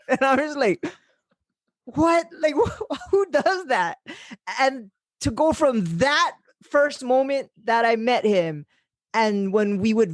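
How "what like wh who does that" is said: high, stunned and amused; can't believe he taped his mouth; laughing disbelief. Retold or imitated. Reacting to the friend's taped mouth, high laughing disbelief.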